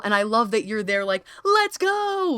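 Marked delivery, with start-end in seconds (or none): 1.4-2.3 high, bright cheer of encouragement; cheering let's go. Retold or imitated. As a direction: cheer them on, pitched way up. Cheering guest on, quoted.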